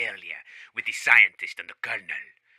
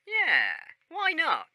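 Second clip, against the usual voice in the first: squeaky protest; slower than usual. Acted.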